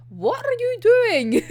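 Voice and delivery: slow, high-pitched mock outrage; incredulous outburst. Retelling a manga character confronting his friend, incredulous.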